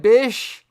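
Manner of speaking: loud mocking "Bish" for bitch; missing T. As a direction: parrot "Bish" ending on sh, no T. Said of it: "can't even pronounce the T".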